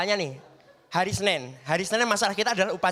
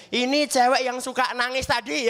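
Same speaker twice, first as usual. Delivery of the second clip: slow, deliberate mock introduction; slow teasing guide; 3 semitones higher than usual. Senior introducing the crying girl to new students, slow teasing. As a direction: point her out slowly as part of the tour.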